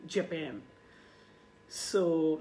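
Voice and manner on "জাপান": "জাপান" as je-PAEN, falling; English pan ending on "জাপান"; correct pronunciation. Shown as a demonstration. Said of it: "উচ্চারণটা আপনি অবশ্যই ঠিক রাখবেন".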